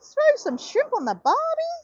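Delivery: slow, high-pitched exaggerated Australian catchphrase; Paul Hogan. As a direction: put on a big, bad Australian accent. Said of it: "that's what he said".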